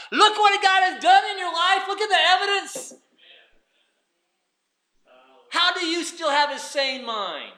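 ringing preacher's call; preaching climax. Real, not acted. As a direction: drive the point home to the church.

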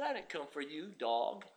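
a quiet, cold rebuff from Jesus; low stern reply. Retold or imitated. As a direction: put her down quietly. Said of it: "He said".

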